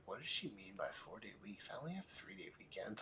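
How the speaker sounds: hushed, fading into a whisper; puzzled inner thought. Retold or imitated. Student walking down the hallway puzzling it out, whispered. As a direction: wonder under your breath. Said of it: "walking down that whole hallway and being like".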